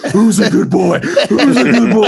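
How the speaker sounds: high, slow doggy talk; baby talk to dog. Retold or imitated. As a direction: coo at the dog.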